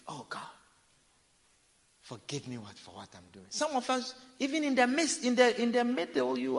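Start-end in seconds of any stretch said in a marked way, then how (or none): 0.1-3.5 much softer, lower, limp plea; mock repentance. Preacher mimicking sinners who ask forgiveness, a soft, lower hollow plea. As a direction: mumble a hollow sorry to God.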